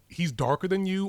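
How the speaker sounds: normal talking voice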